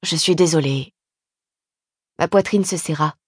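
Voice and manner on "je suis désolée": low, subdued apology; remorseful. A narrator's reading. Husband confessing to his sister-in-law, remorseful and low. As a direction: apologise with held-back remorse. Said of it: "quelque chose qui ressemblait à du remords caché".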